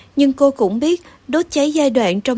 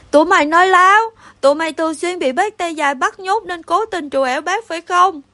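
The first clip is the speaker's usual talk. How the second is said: anguished yell; 5 semitones higher than usual. A narrator's reading. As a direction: cry out more from hurt than anger. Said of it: "nó gào lên vì đau đớn hơn là giận dữ". (gào lên vì đau đớn)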